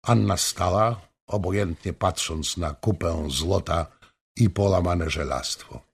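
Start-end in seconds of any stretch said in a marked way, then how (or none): none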